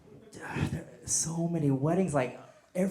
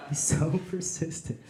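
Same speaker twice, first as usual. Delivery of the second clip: lightly gushing, giggling; swooning girl voice; 3 dB quieter than usual. Retold or imitated. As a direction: gush with a giggle. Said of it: "you're telling your friend".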